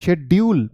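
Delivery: शेड्यूल said "shed-YOOL" with a hard d; mistaken d sound. Shown as a demonstration. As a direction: say शेड्यूल with a plain d, no j. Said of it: "बहुत लोग बोल देते हैं शेड्यूल". (शेड्यूल में साफ़ "ड" की आवाज़)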